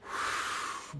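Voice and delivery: long, voiceless puff of air; blowing breath. Acted. Wizard blowing stardust over the party, soft airy whoosh. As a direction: blow out as if scattering dust.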